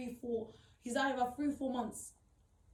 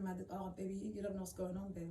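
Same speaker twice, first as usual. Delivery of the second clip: put-on soft murmur to the baby; soothing baby; 4 semitones lower, 7 dB quieter than usual. Mother murmuring to her baby amid the fighting.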